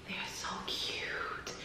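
breathy hushed whisper of delight. Real, not acted. Vlogger whispering over cute earrings from a brand. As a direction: whisper it like a secret thrill.

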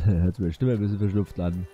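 normal talking voice

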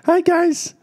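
put-on high baby-like squeak; toddler voice. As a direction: copy the little girl's tiny voice. Dad echoes toddler's greeting.

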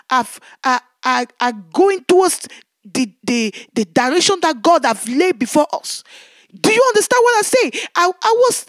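normal talking voice

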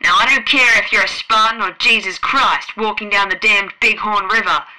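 loud, furious dressing-down; angry admiral. A narrator's reading. The Admiral berates Fred and demands he obey, loud and angry. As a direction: hammer the order home.

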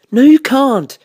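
high, loud motherly refusal; Missus Bear. A narrator's reading. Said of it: "said Missus Bear".

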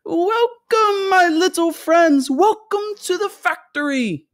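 very high, drawn-out joyful welcome; loud, fluty, gleeful greeting. A narrator's reading. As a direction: call out in a high, fluty singsong. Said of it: "His voice was high and fluty".

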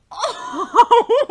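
loud, high whoop with laughter; excited shriek. Real, not acted. Reader reacting to a fight in manga, shrieking. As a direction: let out a high, laughing scream.

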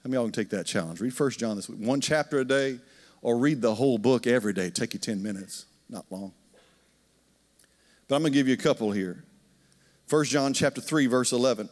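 usual speaking voice

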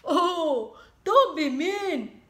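high, loud sniffly whine; sniffled plea. Acted. Wolf whining at the brick house. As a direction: whine through a runny nose. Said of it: "sniffled Big Bad".

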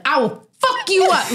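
high mock rage at a stranger; retold road rage. Retelling cursing at a driver, high mock rage. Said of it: "I was like, crazy".

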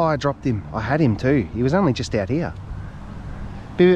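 ordinary delivery

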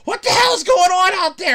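imitating an alarmed mother yelling; high, loud yell from the next room; startled mom. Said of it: "scare the crap out of my mom".

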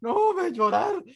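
high, whiny fake sob in the voice; comic mock weeping; mock crying. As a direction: pretend to break down, hammed-up. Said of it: "me voy a poner a llorar".

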